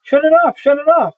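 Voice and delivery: high, loud frantic command; panicked shout. Acted. Willie begs Howard to stop the machine, shouting. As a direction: yell it twice in panic.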